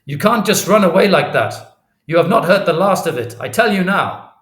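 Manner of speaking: loud, high, indignant shout; calling out angrily. A narrator's reading. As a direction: shout a threat after someone walking off. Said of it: "he calls".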